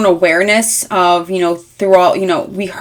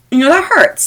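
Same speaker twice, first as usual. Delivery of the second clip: hurt; 9 semitones higher, 3 dB louder than usual. Real, not acted. Tarot reading, empathizing.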